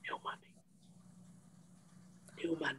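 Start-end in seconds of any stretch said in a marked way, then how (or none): 0.0-0.5 whispered aside. Acted. Aside muttered under breath.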